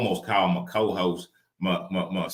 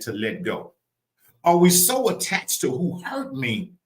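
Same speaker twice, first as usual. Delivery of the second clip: raised, emphatic preacher delivery; preaching; 8 semitones higher, 3 dB louder than usual. Real, not acted. Preacher challenging listeners on attachment, preaching. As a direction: press the question on the listeners.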